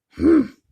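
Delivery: big, loud scornful lion huff; loud humph. Acted. Lions snort at the cow.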